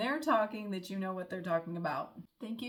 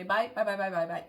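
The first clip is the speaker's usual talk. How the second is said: quick repeated louder byes trailing off; rapid Irish phone goodbye; 6 dB louder than usual. Retold or imitated. Imitating locals ending a phone call, louder quick byes. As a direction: rattle off bye bye bye like ending a call. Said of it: "they'll say it over and over".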